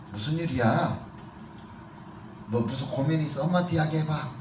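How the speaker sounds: put-on a mother quietly inviting her teen to talk; tender, soft-spoken mother to a troubled teenager; gentle mother.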